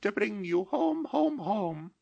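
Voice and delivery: put-on very high child's voice; a small sister, eager and loving; sweet little sister pleading; little girl voice. A narrator's reading. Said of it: "bending down to laugh".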